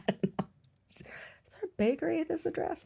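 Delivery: very high, slow mock voice; woman customer. Woman asking at the gallery, slow high mimicry. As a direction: drag out the question in falsetto.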